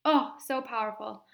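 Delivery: normal talking voice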